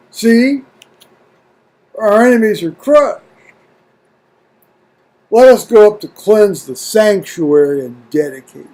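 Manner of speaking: measured, solemn reading of scripture. Acted. Reading First Maccabees to his viewers, solemn reading voice. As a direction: read it out like an old account, steady and deliberate.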